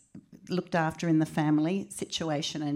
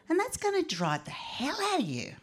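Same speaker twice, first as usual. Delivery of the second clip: exasperated; 11 semitones higher than usual. Retold or imitated. Grandma's warning.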